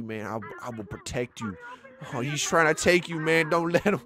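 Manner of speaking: ordinary delivery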